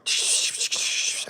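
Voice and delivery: soft repeated shushing; shushing noise. Pretend. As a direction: make repeated shh sounds as cover noise. Noise to cover a whisper.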